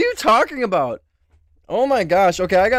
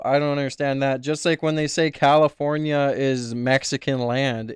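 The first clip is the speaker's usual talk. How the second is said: lower, softer, deadpan mock opinion voice; ironic bigot persona; 7 semitones lower, 4 dB quieter than usual. Playing a smug persona for chat, lower and deadpan. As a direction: play a smug know-it-all straight.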